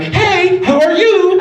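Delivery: mock a relentlessly perky girlfriend; bubbly girlfriend. Comedian mocking a cheerful partner greeting him, high and bubbly. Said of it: "be all bubbly all the fucking time".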